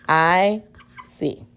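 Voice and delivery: ordinary delivery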